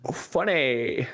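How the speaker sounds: put-on slow, drawn-out Valley Girl "funny". Co-host answers with a slow John Mulaney-style Valley Girl voice. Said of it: "He's going Valley Girl".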